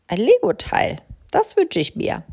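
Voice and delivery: high delighted squeal; delighted crow. A narrator's reading. Crow rummaging her cupboard, delighted. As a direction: pounce on a treasure.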